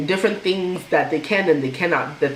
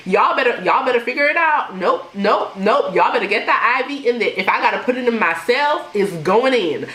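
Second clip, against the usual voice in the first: lightly voiced southern sass, a bit higher; southern accent; 5 semitones higher than usual. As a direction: play the bossy godmother in a southern accent. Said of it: "she started getting real southern on them".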